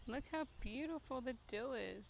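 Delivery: normal talking voice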